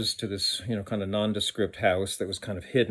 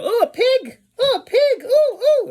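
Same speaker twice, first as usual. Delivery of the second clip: much higher, louder, cooing over a pig like an excited visitor; 10 dB louder than usual. Retold or imitated. Visitors meeting the pig, much higher excited cooing. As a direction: squeal it out like someone meeting a piglet. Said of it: "people would go".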